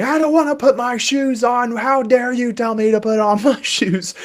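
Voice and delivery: high, loud mock child tantrum; screaming child. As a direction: ham up a kid refusing shoes. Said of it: "Even when they are screaming and saying".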